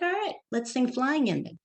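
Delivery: put-on humouring a little kid; mock concession. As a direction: concede playfully in a high drawn-out voice. Mom humouring child's objection.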